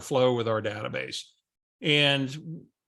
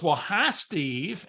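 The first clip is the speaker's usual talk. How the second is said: usual speaking voice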